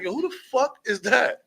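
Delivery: high-pitched mock alarm; incredulous question. Party guests spotting a stranger, incredulous high question.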